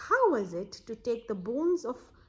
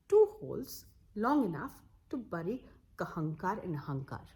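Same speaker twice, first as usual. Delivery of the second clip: usual speaking voice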